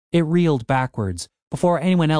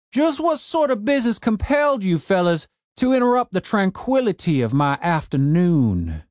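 put-on cranky old man; 7 semitones higher than usual. A narrator's reading. Old man ordering his broken zombie gardener, gruff old voice.

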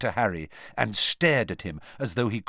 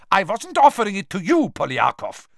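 high, loud snapping retort; snapped; 10 semitones higher, 7 dB louder than usual. A narrator's reading. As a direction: bite the words off sharply. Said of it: "snapped Karkaroff".

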